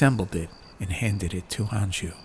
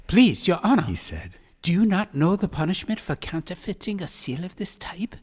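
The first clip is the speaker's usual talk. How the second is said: 1 anxious master; 9 semitones higher than usual. A narrator's reading. Master frightened by seal.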